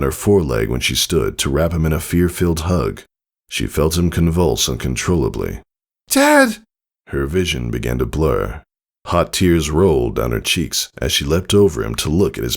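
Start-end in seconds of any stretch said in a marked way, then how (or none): 6.1-6.6 high, loud frantic call; panicked cry. Acted. Daughter finding father hurt on the ground, frantic high cry.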